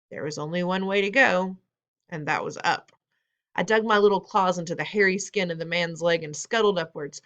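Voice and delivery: ordinary delivery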